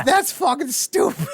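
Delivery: high, loud, drawn-out cry; mock outraged yell. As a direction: yell it slowly in exaggerated disbelief. Mock outburst over the lost pen.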